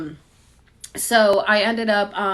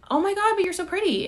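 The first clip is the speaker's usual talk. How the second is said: much higher, gushing lady voice; gushing stranger; 11 semitones higher, faster than usual. Retold or imitated. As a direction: coo it like an overexcited stranger. Voicing the gushing lady at Chili's.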